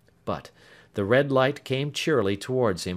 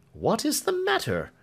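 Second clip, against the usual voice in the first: alarmed exclamation; 13 semitones higher than usual. Acted. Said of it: "he exclaimed".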